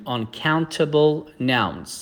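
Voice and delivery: very slow, each syllable laid out; slow teacher's model of the phrase; slow model reading. Shown as a demonstration. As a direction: spell the phrase out slowly for learners. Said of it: "یاد بگیریم".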